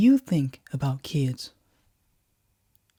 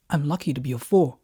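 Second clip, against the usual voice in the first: ordinary delivery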